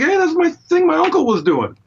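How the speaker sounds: high-pitched retold amazement; excited recognition. Guitarist recalling hearing his uncle's riff on a record, high excited voice. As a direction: act out a thrilled discovery, pitch leaping way up.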